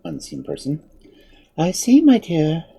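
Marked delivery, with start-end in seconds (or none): 1.6-2.6 slow, high womanly voice; soft womanly voice. A narrator's reading. Unseen mother answering her child, soft and womanly. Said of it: "soft and womanly".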